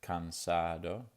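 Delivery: "cansado" with the middle syllable "sa" stressed, slow; Spanish "cansado" stressed on the second syllable. Shown as a demonstration. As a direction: lean on the "sa" in "cansado". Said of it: "underlined sa".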